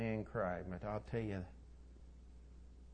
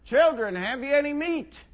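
high, loud hail across the water; calling out; 11 semitones higher, 10 dB louder than usual. Acted. Stranger on the shore hails the fishermen, calling out. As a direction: holler it to men far offshore. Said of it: "he calls out".

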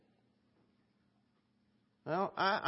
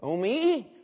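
ordinary delivery

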